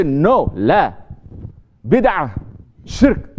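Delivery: loud, scolding string of forbidding words; mock angry condemnation. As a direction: bark the accusations like a zealot. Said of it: "They always say".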